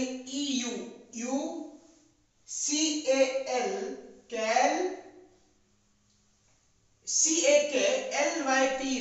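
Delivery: letters in small groups, each followed by its sound; spelling drill. Shown as a demonstration. Teacher helps children memorise a spelling, chunked spelling drill. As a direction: chant out each chunk with pauses, then its syllable. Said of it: "spelling कैसे याद करेंगे".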